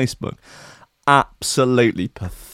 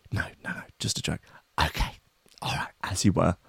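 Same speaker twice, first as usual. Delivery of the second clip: unvoiced hushed reply; whispered exchange; 5 semitones lower, 10 dB quieter, faster than usual. Creator and agent trading a hushed whispered exchange. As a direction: whisper it like a secret.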